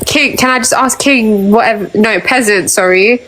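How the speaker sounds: ordinary talk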